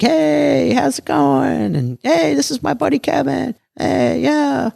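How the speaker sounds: jumps way up into a high, airy greeting voice; high mock greeting. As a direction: mimic a breezy, too-friendly hello in a high voice. Retold greeting of dealers.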